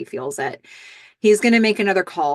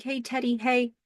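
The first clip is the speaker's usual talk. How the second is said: small, soft, higher imitated voice; almost crying; 6 semitones higher, 6 dB quieter than usual. Billy greets Teddy on the phone, almost crying. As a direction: imitate holding back tears in a quiet hello. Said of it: "He's almost crying".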